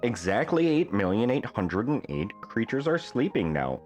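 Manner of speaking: usual speaking voice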